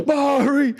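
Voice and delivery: laughing through the words; giggling while asking. Real, not acted. Host laughing through question.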